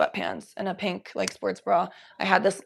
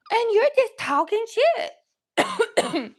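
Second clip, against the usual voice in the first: high, slow teasing pet voice; 4 dB louder, much slower than usual. Real, not acted.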